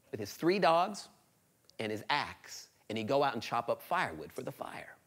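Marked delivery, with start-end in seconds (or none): none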